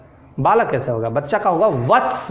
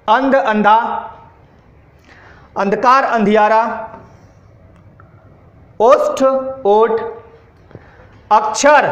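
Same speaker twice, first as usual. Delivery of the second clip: dictation. Acted. Teacher dictates word list. (धीमी, साफ़ आवाज़ में श्रुतलेख बोलना)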